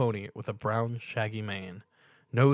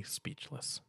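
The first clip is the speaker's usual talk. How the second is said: breathy whisper, much softer; 4 semitones higher, 15 dB quieter than usual. A narrator's reading. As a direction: let the word fall to a whisper. Narrator whispers the ending word.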